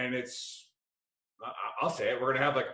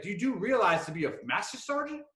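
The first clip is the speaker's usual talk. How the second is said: higher-pitched mimicked accent, incredulous; King's Buddy Beckwith accent; 7 semitones higher than usual. Buddy asking about master sergeant rules, incredulous mimicked accent. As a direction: imitate his accent in lightly put-on disbelief. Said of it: "his Buddy Beckwith accent".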